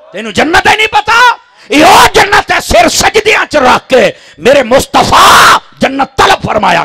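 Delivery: shouted high preaching, slow and loud; fiery sermon. Acted. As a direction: preach it out at full force to the crowd. Preacher at full force.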